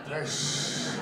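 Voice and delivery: loud rushing "pssh" noise; imitated gushing sound. Shown as a demonstration. Comic showing Scottish word for a wee, as sound effect. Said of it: "you use sounds of what it sounds like".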